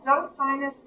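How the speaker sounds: usual speaking voice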